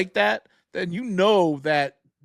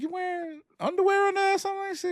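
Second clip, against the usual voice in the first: pitched way up, teasing; mock high squeaky quote; 13 semitones higher, 6 dB quieter than usual. Husband quizzing his wife, in a mock high voice.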